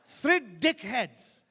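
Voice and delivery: very slow, loud orator's repeat; Mandela impression. Statesman repeating his point to the crowd, drawn-out oratory. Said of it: "his opening speech, he went".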